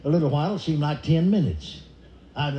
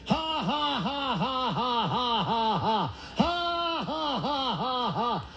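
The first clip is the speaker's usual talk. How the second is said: high, mechanical ha-ha laugh stepping down; forced fake laugh; 7 semitones higher, 5 dB quieter, much slower than usual. Shown as a demonstration. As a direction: pump out high, evenly spaced fake laughs. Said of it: "You're gonna laugh".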